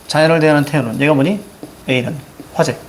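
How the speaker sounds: ordinary talk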